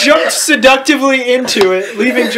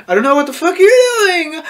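mock angry shouting; 6 semitones higher than usual. Reader plays the professor shouting at a snooper, mock angry shouting. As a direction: build into hammed-up fury, nearly laughing. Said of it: "I'm shouting angrily".

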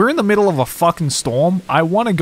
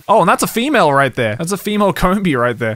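usual speaking voice

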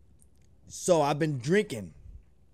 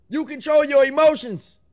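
higher and louder, stress on YOUR; loud emphatic declaration; 8 semitones higher, 6 dB louder than usual. Real, not acted. Reactor hammering his anger lesson to viewers, loud emphatic declaration. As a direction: drive the point home like a coach.

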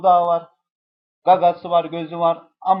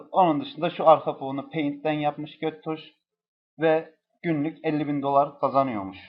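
normal talking voice

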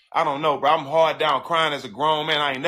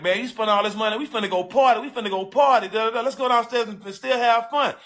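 higher, eager coaxing; hyped-up friend; 6 semitones higher than usual. Retold or imitated. Friend urging the jilted groom downstairs to party, hyped. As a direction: imitate a friend pushing to go party.

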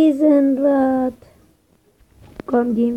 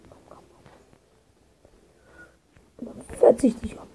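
hushed menacing whisper; whispered threat; 11 dB quieter, faster than usual. Acted. Sneaking threat.

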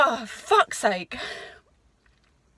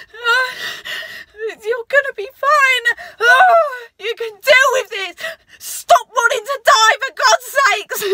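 higher, louder, voice breaking with tears; crying pep talk; 7 semitones higher, 8 dB louder than usual. Real, not acted. [name] begging herself not to give up, crying loudly. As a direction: plead with yourself through sobs.

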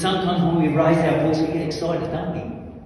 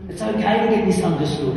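ordinary delivery

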